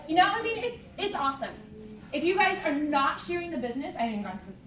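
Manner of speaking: ordinary talk, nothing marked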